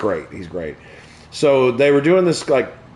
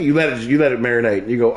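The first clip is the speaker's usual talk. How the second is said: ordinary talk, nothing marked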